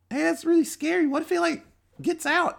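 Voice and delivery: very high falsetto caricature; nervous youngster. Acted. Young worker worries the body escapes, nervous youngster. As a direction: fret in a squeaky put-on voice.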